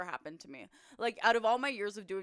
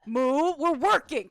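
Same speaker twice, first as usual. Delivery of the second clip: loud, slow, raised pitch; calling out; 5 semitones higher, 10 dB louder, slower than usual. Real, not acted. Streamer telling Moo they're working, loud protest. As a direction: tell off a pet, drawing it out. Scolding pet during stream.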